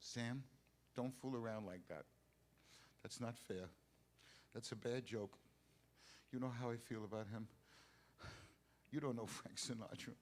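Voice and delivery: soft, low, halting reproach; quiet, wounded disbelief. Acted. Singer telling manager it's a bad joke, quietly hurt. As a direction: plead quietly, with pauses between short phrases.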